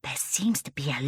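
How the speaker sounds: whisper. Acted. Child awed in the zoo.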